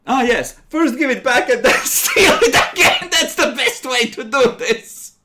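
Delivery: high, loud laughing sarcasm; mock approval while laughing. Real, not acted.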